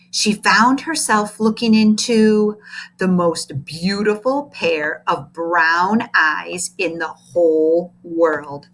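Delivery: usual speaking voice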